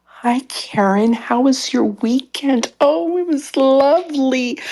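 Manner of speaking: high, drawn-out sing-song small talk, exaggerated. Shown as a demonstration.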